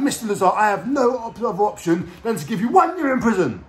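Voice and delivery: high, forceful judge handing down a sentence; angry judge. Retold or imitated. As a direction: imitate an irate judge passing judgment.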